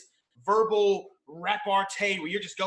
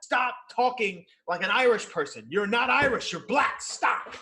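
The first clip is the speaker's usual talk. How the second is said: louder and higher, half laughing; mock self-scolding; 6 semitones higher, 5 dB louder than usual. Caller telling himself off for a borrowed Irish accent, mock loud. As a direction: scold yourself with a grin.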